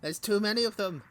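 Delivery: hammed-up panic at the horde; mock alarm, voice pushed up; panicked. Overrun by pumpkins, panic.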